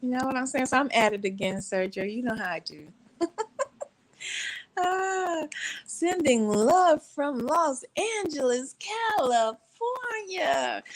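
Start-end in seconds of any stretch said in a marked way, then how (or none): 4.8-10.8 high, slow, drawn-out greeting; playful shout-out. Pretend. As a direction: stretch each place name out playfully, pitched high. Playfully reads out a viewer's greeting.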